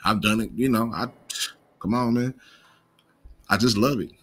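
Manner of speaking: ordinary talk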